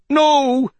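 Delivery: high, loud cry of terror; a long, high wail of refusal. A narrator's reading. Old woman clinging to the mystic as bear nears, crying out. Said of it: "squeezing her and crying".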